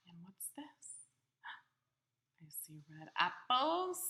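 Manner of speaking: put-on hushed secret whisper to the child. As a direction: whisper as if sharing a discovery. Secret discovery while reading to child.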